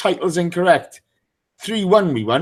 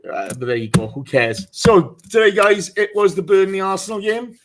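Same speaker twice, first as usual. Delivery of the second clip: usual speaking voice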